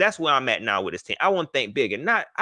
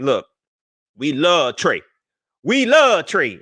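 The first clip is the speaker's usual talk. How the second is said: imitating fans; 3 semitones higher, 6 dB louder, slower than usual. Mimicking Panthers fans, pleading louder. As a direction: plead like a devoted fan. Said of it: "they like".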